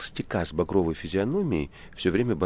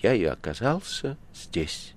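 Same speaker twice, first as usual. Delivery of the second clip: slow, clouded, wistful ending; saddened; 4 semitones higher, slower than usual. A narrator's reading. Ivan ends his story, saddened. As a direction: finish slowly, with a touch of sadness. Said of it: "загрустив и затуманившись".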